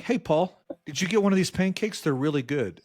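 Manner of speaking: lightly mocking cheerful colleague voice; imitated oblivious HR lady. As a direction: play her pleased and clueless. Imitating HR happily eating pancakes.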